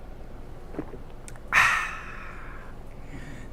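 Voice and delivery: long, breathy "aah" of relief after drinking; satisfied sigh. Pretend. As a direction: let out a contented sigh once the thirst is quenched. Drinks water, then satisfied.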